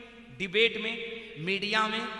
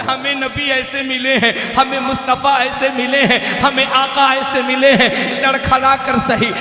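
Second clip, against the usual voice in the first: loud, driving oratory in parallel phrases; full-force preaching; 6 semitones higher, 16 dB louder than usual. Acted. Preacher urging Muslims to live for the Prophet, impassioned loud exhortation. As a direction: exhort the crowd, building to a high shouted climax.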